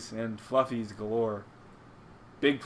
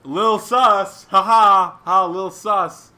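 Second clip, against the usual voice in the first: loud, high, drawn-out mock laughing; hammed-up, louder, higher teasing; mocking jeer; 6 semitones higher, 11 dB louder, much slower than usual. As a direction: imitate a forum poster laughing it off. Mocking weak forum reactions.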